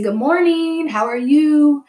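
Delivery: high, sing-out cheery hello; polite, friendly greeting. Retold or imitated. Student greeting the school staff, polite and friendly. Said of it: "I was just very polite, friendly".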